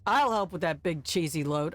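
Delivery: ordinary talk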